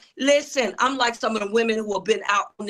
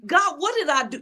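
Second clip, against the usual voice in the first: anguished plea; 6 semitones higher than usual. Retold or imitated. Retold anguished plea to God.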